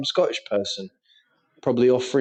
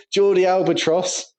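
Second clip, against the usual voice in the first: ordinary talk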